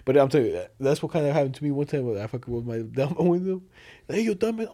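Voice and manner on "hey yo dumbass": higher-pitched retold call-out; mock heckle. Retelling a driver's insult to a friend, mock heckle. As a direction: imitate a stranger calling across lanes. Retells stranger shouting from car.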